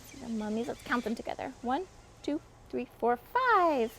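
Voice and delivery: ordinary delivery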